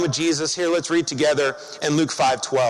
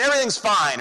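ordinary delivery

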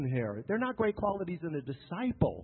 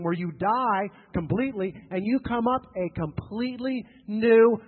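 loud, raised, emphatic pulpit delivery; full-force preaching; 5 semitones higher, 8 dB louder than usual. Real, not acted. Preacher telling congregation baptism is death and new life, full-force preaching. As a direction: drive each phrase home to the congregation.

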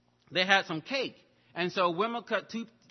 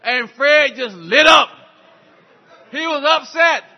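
preacher's shout; 7 semitones higher, 15 dB louder than usual. Acted. Preacher shouts the story climax.

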